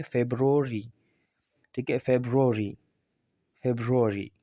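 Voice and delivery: फेब्रुअरी said febrori; correct February. Shown as a demonstration. Teacher drills class on फेब्रुअरी as febrori. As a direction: repeat फेब्रुअरी as feb-ro-ri. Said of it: "ये इसका exact pronunciation है".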